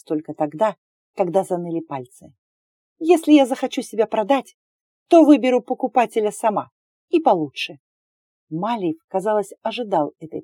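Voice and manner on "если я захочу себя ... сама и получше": higher, louder defiant answer; angry retort. Acted. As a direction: spit the words back at him. Heroine answers after the slap.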